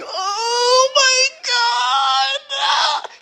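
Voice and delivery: very high, drawn-out crying wail; mock crying. The Hulk wailing over a bee sting, mock crying. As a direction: bawl it out in exaggerated agony. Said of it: "crying ... this is like seeing the fucking Hulk get stung".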